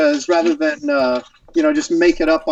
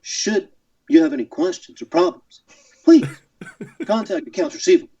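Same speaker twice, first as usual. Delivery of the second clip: halting captain with sudden rush at the end; Captain Kirk. Shown as a demonstration. Guest reading an invoice as the captain, staccato drama. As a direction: make dull billing text portentous, with big pauses. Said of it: "much better with Kirk when I can read something".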